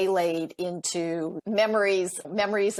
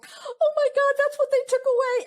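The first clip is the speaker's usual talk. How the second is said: very high, louder squeal of mock horror; shocked realisation; 5 dB louder than usual. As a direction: exclaim it aghast, pitch shooting right up. Acting own shock at client's takeaway.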